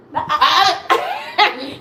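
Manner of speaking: loud, bright laugh; laughing as her daughter grabs for the phone. Real, not acted. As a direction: burst out laughing. Child wants the phone.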